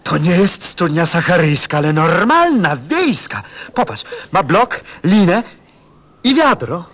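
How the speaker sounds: overjoyed cry at finding the well; excited discovery. Acted. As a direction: cry out in amazed joy. Describing the real well excitedly.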